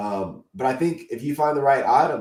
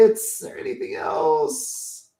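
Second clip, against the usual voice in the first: tired cracking voice after an hour; losing voice; 8 semitones higher than usual. Real, not acted. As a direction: voice giving out, breaking. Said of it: "I'm losing my voice".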